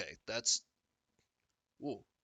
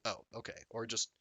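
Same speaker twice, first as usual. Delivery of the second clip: ordinary delivery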